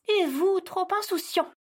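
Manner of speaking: high pointed jab at his carelessness; sharp retort. Acted. Elf snaps back at carefree Santa, high and pointed. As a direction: throw it back at him.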